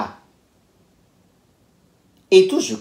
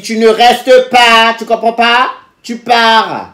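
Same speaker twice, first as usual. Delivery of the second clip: high, loud retold dismissal; firm angry order; 5 semitones higher, 5 dB louder, slower than usual. Refusing to let her date stay, loud angry order. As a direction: order him out, loud and drawn out.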